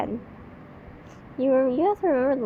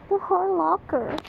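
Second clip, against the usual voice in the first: higher, whiny mock sympathy; mock pity; 6 semitones higher than usual. Real, not acted. Reacting to the story, pitying the locker. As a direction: moan it for the locker.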